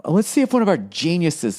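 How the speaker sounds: straight-faced clerk. Retold or imitated. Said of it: "they literally say it with a straight face".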